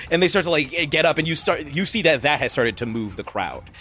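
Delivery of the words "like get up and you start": ordinary delivery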